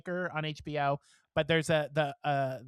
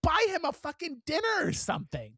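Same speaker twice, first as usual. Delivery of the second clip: indignant outburst; 7 semitones higher than usual. Retold or imitated. To the passengers who gave one dollar, an indignant, rising outburst. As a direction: scold the crowd for giving a dollar. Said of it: "wanted to stand up and be like".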